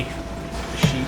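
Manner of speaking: hushed, disbelieving whisper. Acted. Disbelief at the sheep.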